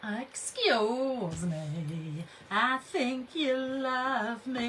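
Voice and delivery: playful put-on voice; silly voice. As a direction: drag it out in a goofy character voice.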